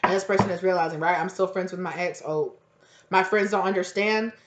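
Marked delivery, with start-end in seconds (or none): none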